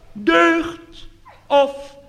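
very high, fading echo of zonde; hammed-up reverb; echoing sound system. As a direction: mimic a church echo dying away. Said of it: "Er zit een galm in".

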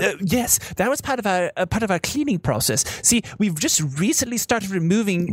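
high-pitched, faltering guide voice; explaining tour guide. Acted.

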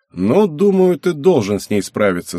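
mildly hesitant encouragement; a touch of doubt, tailing down; unsure. A narrator's reading. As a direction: reassure without quite believing it. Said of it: "закончил он неуверенно".

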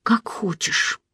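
very soft, meek reply; submissive. A narrator's reading. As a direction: yield quietly, barely voiced. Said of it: "покорно ответила". (покорно, очень тихо)

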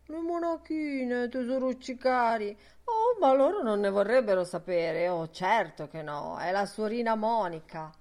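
mock sweet little nuns with pursed lips; affectedly dainty muttering to oneself, not hushed; mincing, simpering mimicry. A narrator's reading. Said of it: "mormorando tra sé e facendo boccuccia gentilmente".

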